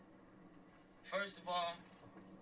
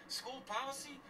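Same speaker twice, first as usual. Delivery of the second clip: higher-pitched, questioning echo of their excuse; incredulous echo; 7 semitones higher than usual. Retold or imitated. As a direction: throw the excuse back as a disbelieving question. Scoffing at the school's excuse.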